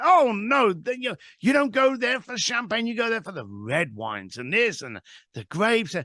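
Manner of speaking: retold excited caller, pitch lifted. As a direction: correct them eagerly, as a wine lover would. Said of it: "He's excited".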